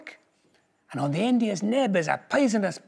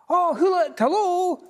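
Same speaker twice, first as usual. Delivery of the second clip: high, bright squeak of a greeting; a tiny mouse waving hello; cheeky mouse greeting; 12 semitones higher, 4 dB louder than usual. A narrator's reading. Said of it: "mouse greets the owl".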